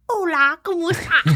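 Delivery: Spanish spoken the normal way; plain Spanish greeting, no British accent. Retold or imitated. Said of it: "They're not like".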